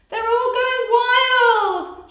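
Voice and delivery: high, loud, slow sing-song cry; shout. Acted. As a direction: wail that chaos has broken out. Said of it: "shouted the nurse".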